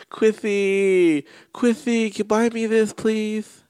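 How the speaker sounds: high, whiny lisped begging; lisping impression. Friend mimicking her begging to be bought something, lisping whine. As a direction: wheedle like a lisping child. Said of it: "that's another impression".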